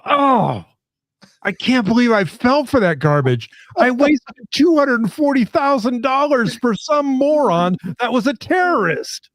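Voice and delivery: high, whiny wail of a future graduate; mock-cry over wasted tuition; hammed-up whining regret, high and wailing; regretful graduate. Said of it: "they're like".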